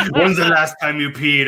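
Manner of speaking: a lightly voiced nurse, laughing through; nurse question. Nurse waking him at night, laughing mock question. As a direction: ask it again, laughing. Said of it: "It's like".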